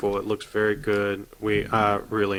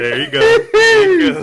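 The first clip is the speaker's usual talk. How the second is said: squeaky cheer; 14 dB louder than usual. Acted. Cheering on impression.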